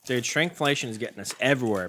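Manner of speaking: normal talking voice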